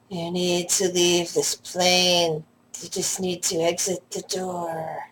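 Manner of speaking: slow, soft, drowsy otherworldly voice; sleepy dream voice. Acted. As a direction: speak slowly as if half asleep, barely above a murmur. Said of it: "it's kind of like a sleepy dream voice".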